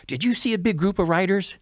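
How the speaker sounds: high-pitched retold call to bystanders; urgent asking. Lost racer asks groups of people along the path, urgently. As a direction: ask urgently, pitch lifted.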